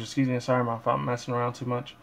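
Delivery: ordinary delivery, nothing marked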